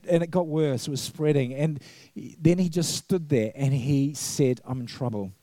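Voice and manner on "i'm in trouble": thought aloud. Retold or imitated. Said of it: "He didn't say it, he thought it".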